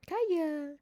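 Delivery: high, drawn-out name call; calling the cat. Real, not acted. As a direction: call your pet from across the room.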